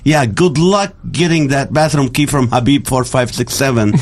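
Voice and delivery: usual speaking voice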